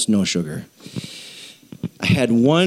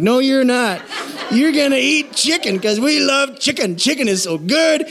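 mock pep talk; 11 semitones higher than usual. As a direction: hype yourself up to eat chicken.

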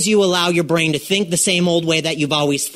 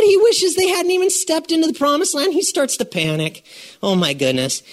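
usual speaking voice

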